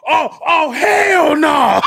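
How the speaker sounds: high, slow drawn-out yell of outrage; mock outraged yell. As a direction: stretch out the outrage like a wrestler losing it. Quoting angry King Booker.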